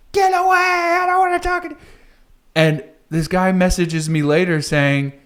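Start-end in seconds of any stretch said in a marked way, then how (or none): 0.1-1.8 imitated scream. A woman screams at him to get away, imitated high shriek. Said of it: "and she screams".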